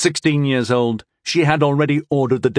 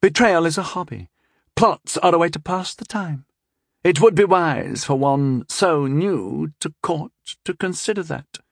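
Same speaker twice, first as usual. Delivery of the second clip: scheming princess voice; 7 semitones higher than usual. Acted. Princess lecturing.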